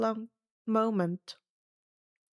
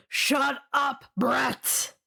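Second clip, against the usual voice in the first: curt, irritated snap; snapping; 3 semitones higher, much slower than usual. A narrator's reading. Mister D silencing the arguing campers, a mild snap. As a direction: bite the words off impatiently. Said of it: "Mister D snapped".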